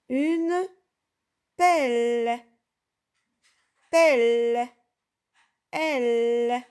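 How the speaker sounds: "pelle" and "elle" called out loud and separately; loud syllable drill. Shown as a demonstration. Teacher to pupils, drilling the "elle" sound loudly. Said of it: "drilling the syllable".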